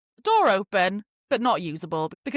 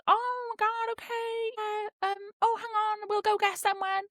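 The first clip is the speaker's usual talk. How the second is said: put-on young girls' voices; 13 semitones higher, 4 dB quieter than usual.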